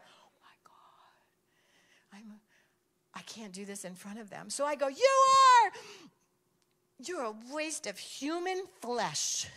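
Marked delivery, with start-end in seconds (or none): 0.0-4.5 whispered, horrified aside; sussurro de pânico. Retold or imitated. Whispered aside inside the story. 4.9-9.5 drawn-out, halting retold outburst; mock angry insult. Angry insult acted in story.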